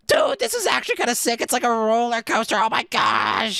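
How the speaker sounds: high-pitched excited ride reaction; thrilled. Real, not acted. Gamer riding a minecart coaster, thrilled. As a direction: exclaim it high, stretching the end.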